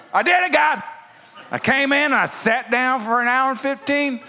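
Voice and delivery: high, loud, hammed-up self-congratulation; mock boastful churchgoer. Preacher mimics churchgoer boasting to God, mock boastful churchgoer. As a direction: proclaim it high and loud, as if a great feat.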